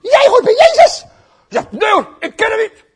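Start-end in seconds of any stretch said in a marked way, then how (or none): 0.0-1.0 put-on very high, loud child's squeal; mock child shriek; tiny girl. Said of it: "Komt er een nog kleiner meisje". 1.7-2.8 high shouted denial; hammed-up defensive yell; frantic mock denial. Peter denies, acted.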